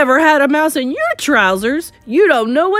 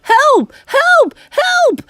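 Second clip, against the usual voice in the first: shrieked cries for help, climbing higher; screaming; 8 dB louder, much slower than usual. A narrator's reading. Man with a mouse in his trousers, screaming. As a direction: scream louder and far higher than usual. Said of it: "the man was screaming".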